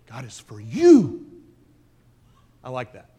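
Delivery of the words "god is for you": you pushed up, slow and louder; God is for YOU; stress on you. Shown as a demonstration. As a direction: land hard on the word you. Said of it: "he emphasizes each word".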